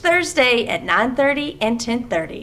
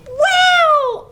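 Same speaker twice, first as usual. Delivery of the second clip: high, louder "Wow" shout; excited call; 5 dB louder than usual. Acted. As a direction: call the whole group in with glee. Frog excited, calls everyone.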